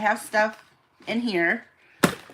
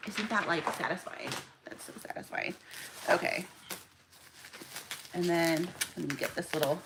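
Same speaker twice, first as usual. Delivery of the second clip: softer and softer, down to a whisper; hushed whisper; 4 semitones lower, 12 dB quieter than usual. Real, not acted. Sealing the box, she whispers how satisfying it feels. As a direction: breathe the words, savoring it. Pressing bubble wrap sticker down.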